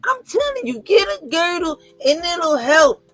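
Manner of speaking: high cracking mock-cry of a mother; imitating mom. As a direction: beg like an insistent mother. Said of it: "She said".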